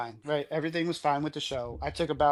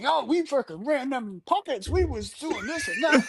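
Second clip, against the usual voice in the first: put-on drunk bragging; 11 semitones higher than usual. Drunk friends boasting about the pockets, high mock brag. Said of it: "and they're like".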